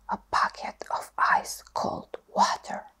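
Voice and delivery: usual speaking voice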